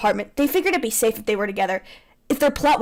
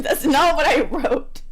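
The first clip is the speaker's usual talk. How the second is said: laughing protest; 3 semitones higher, slower than usual. Real, not acted.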